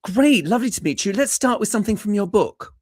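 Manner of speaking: high, bright-sounding lilt of a polite British panel; British audition team. Retold or imitated. British team greeting an auditionee, high sing-song politeness. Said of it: "the British team were like".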